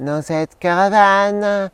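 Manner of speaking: slow, high crooning singer voice; slowed, lifted pop-singer imitation. Shown as a demonstration. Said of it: "Je faisais à la perfection".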